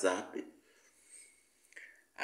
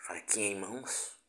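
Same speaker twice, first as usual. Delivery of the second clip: whispering; 11 dB quieter than usual. Real, not acted. Showing the knife on camera.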